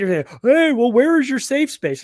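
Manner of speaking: a lightly hammed-up taunting boss; mocking taunt. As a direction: sneer at the idea of a safe space at work. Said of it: "mock boss taunt".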